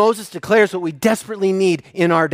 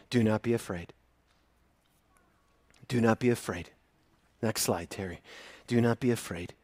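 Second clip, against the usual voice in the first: low, soft and tender, a whispered-close comfort; gentle reassurance; 8 semitones lower, 12 dB quieter than usual. Shown as a demonstration. Pastor comforting his grieving church, soft and low. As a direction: soothe the hurting with each repetition. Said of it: "praying that you would hear God".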